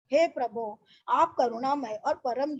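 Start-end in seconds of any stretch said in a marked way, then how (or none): none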